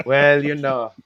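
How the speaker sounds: hammed-up French accent; terrible French accent. Host owning up to touching up old masters, in a terrible French accent. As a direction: bluff along in a bad French accent. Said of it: "my terrible French accent".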